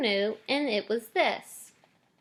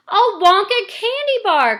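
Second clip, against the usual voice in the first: put-on much higher, loud child's delighted cry; excited cry; 11 semitones higher, 9 dB louder than usual. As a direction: burst out with a boy's joy. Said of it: "cried Charlie".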